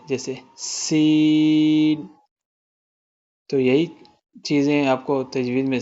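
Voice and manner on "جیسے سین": سین with a long hissing س; whistled seen. Shown as a demonstration. Teacher showing the whistling seen to students, drawn out. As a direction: hiss the س like a whistle. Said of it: "سیٹی کی آواز نکالیں گے".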